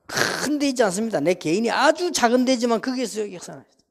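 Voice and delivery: impassioned pulpit exhortation; preaching at full force. Acted. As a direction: preach it out with fervour. Opening exhortation of the sermon.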